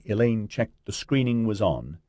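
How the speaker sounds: usual speaking voice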